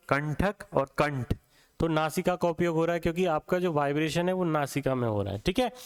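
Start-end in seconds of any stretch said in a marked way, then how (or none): 0.1-1.4 कंठक और कंठ slowly, the nasal न held; slow drill of कंठक और कंठ; slow nasal demo. Shown as a demonstration. Teacher demonstrating nasal vibration to students, slow. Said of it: "करके देख लीजिए".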